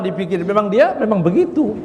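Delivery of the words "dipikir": ordinary delivery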